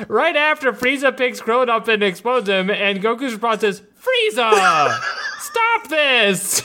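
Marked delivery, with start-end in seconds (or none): 4.0-6.6 laughing mock plea, breaking up; quoted Goku line. Retelling the dub to a friend, laughing mock plea. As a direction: plead at Frieza while cracking up. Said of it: "The exact intonation".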